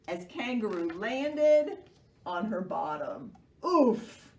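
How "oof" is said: loud high oof; thump grunt. Acted. Kangaroo landing on her bottom, a loud oof. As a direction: grunt as you land hard.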